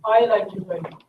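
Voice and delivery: I punched loud and high; I like you very much, with I stressed. Shown as a demonstration. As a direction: lean hard on the word I. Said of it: "Focus on I".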